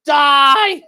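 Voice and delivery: long, high, loud yell; hammed-up murderous scream. Gun-carrier turning on lobsters, hammed-up murderous scream. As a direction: scream it in mock killing rage.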